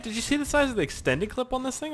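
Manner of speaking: usual speaking voice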